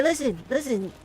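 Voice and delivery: a hammed-up Trump ramble; Trump impression. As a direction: stammer and bluster like Trump on a rant. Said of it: "And they say".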